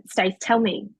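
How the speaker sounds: usual speaking voice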